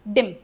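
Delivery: डिम with a brief "ih"; short vowel. Shown as a demonstration. Teacher shows what dropping E does, short vowel. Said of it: "छोटा हो गया".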